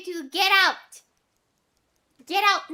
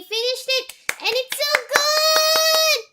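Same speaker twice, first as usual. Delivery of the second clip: gushing; 8 semitones higher, 4 dB louder than usual. Real, not acted. Gushing over finished game.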